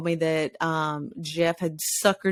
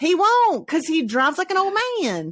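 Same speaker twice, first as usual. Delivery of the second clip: mocking; 9 semitones higher than usual. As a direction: tease like a cocky teen. Retorting about slow driver.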